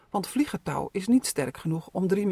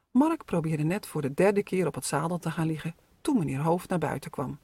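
ordinary delivery, nothing marked

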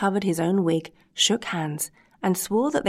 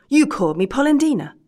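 higher and louder, indignant; angry outburst; 7 semitones higher, 7 dB louder than usual. Acted. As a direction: hurl the accusation back. Old men quarrel over nickname.

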